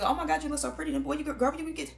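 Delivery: bubbly friend raving over a new look; gushing compliments. Retold or imitated. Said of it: "a real motherfucker".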